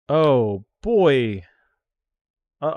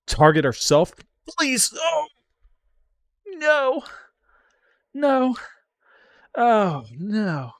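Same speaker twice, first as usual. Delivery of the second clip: very high, louder anguished cries; high pleading wail; 6 dB louder, much slower than usual. Real, not acted. Streamer pleading as the attack goes through. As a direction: plead then wail in despair.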